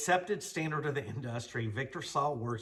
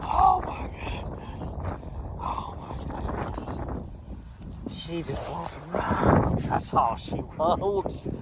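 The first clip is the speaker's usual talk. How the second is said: much higher, slow, airy cooing at the dog; pet talk; 5 semitones higher, 6 dB louder, slower than usual. Real, not acted. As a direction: coo at a dog that wants rubs.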